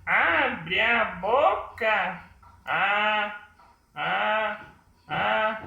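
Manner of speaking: high puppet voice, drawn-out open vowels; slow, high-pitched puppet projecting each open "ah"; puppet voice demo. Shown as a demonstration. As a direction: make the puppet open wide on every vowel. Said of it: "você abre a boca".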